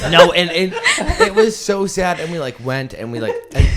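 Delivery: high, wavering voice fighting back tears with a laugh; laugh-crying through the words as she tells the sad part; real tearful crying. Real, not acted. Telling friends about giving the dog back, crying through a laugh. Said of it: "She's for real crying".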